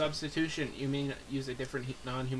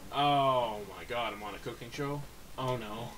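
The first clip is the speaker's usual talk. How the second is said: usual speaking voice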